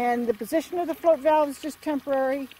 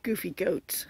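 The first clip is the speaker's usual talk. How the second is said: whispered under her breath; fond whisper; 5 semitones lower, 8 dB quieter, slower than usual. Real, not acted. Owner watching her goats, soft fond whisper. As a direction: breathe it out fondly, no voice.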